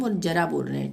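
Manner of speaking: ordinary talk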